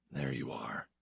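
very soft, low near-whisper; barely audible, low and hushed. A narrator's reading. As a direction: drop right down to a hush. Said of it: "he said in a whisper".

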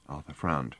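ordinary delivery

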